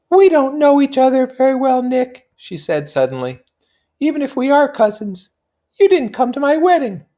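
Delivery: put-on high, light young society woman; Daisy's voice. Daisy confiding in her cousin Nick, high and light. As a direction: lift into a bright, high feminine voice.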